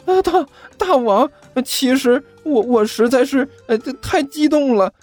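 high, stammering thrilled minion; trembling excitement. Acted. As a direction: babble with thrilled awe. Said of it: "嘴唇颤抖，激动得几乎不能自己".

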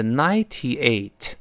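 ninety-eight said slowly with a clear T in ninety; careful "ninety-eight", each syllable distinct; slow careful reading. Shown as a demonstration. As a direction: spell out the T in "ninety-eight", unhurried. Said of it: "读慢了是".

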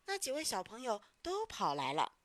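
plain reading voice

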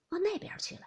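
plain reading voice

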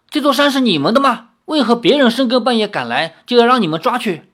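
语速加快、音调拔高，怒气冲冲地反问; 像被冤枉的人当面怒斥; 愤怒质问. A narrator's reading. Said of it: "李逍遥怒道". (李逍遥被围，怒气冲冲地反问队长)

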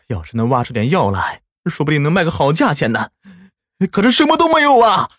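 wailing cry rising to a high shout. A narrator's reading. Li Tiansheng sits on the ground crying, wailing in despair. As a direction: sob out the last line in despair. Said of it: "他累得往地上一坐就哭了".

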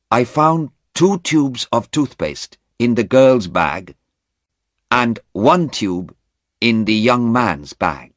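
lightly voiced policeman, slow and emphatic; policeman giving evidence. A narrator's reading. Policeman reporting his find to the court, lightly voiced testimony.

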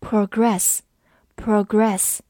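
"progress" stressed on the second syllable, proGRESS. Shown as a demonstration. Teacher shows the verb form to learners, "progress" stressed on its second syllable. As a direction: say "progress" as a verb, weight on "gress". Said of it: "把重音放在了第二个音上面".

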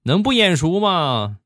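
acted mother's voice, high then easing down; amused mother. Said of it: "我妈在旁边笑了".

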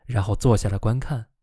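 plain reading voice

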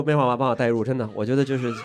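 usual speaking voice

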